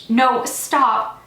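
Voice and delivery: inconsolable protest. A narrator's reading. Man dragged back to car, inconsolable protest. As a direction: shout in distress as you are dragged off. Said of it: "I was inconsolable".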